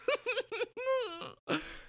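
high-pitched laugh. Real, not acted. Laughing at quitting after all that fighting, high laugh.

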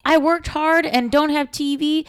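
put-on high, proud kid calling home; earnest kid on phone. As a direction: play a proud patriotic kid. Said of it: "kids calling home like".

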